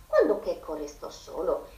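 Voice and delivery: plain reading voice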